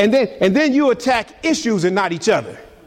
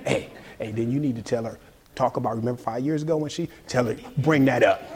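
soft, sly devil voice egging him on; the devil murmuring in your ear, much softer; sneaky devil coaxing someone into an argument, hushed; devil whispering temptation; 6 semitones lower, 7 dB quieter than usual. Acted. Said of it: "And he's like".

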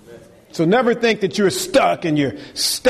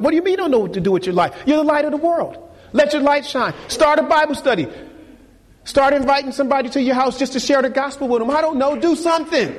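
high, fast, pressing preaching; urgent exhortation; 11 semitones higher, faster than usual. Acted. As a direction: push them to act now. Exhorting the young adults.

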